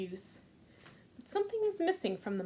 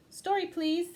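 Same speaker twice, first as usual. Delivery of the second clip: put-on high, pleading kid voice; little boy pleading; 8 semitones higher, 4 dB louder than usual. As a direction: beg sweetly for a story. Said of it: "said Max".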